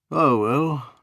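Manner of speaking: weary sighing resignation; deep sigh. Acted. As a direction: sigh it out like a tired old lord. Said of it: "with a deep sigh".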